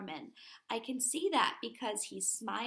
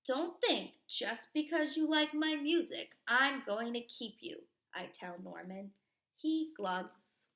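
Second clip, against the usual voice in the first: put-on lively read-aloud for kids; storybook reading; 3 semitones higher, 4 dB louder than usual. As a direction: read it to a class like a picture book. Reading picture book aloud.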